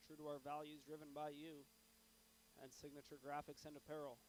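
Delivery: hoarse, flat monotone from a sore throat; monotone voice, throat giving trouble. Real, not acted. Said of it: "I apologize for my monotone voice".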